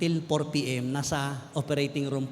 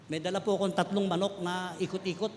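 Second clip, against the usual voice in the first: ordinary delivery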